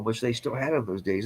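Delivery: ordinary talk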